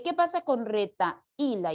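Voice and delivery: ordinary talk, nothing marked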